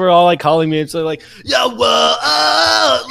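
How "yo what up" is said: drawn-out, high mock holler; imitating excited friends. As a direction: holler it like hyped friends on a call, stretched out. Friends excitedly calling to congratulate.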